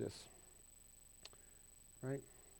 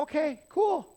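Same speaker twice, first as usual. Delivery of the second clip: higher, louder, easy-going okay cool; casual listener; 10 semitones higher, 8 dB louder than usual. Pretend. Congregation accepting the pastor's quirks, higher and louder. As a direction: act out a shrugging congregation member. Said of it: "you guys are gonna go".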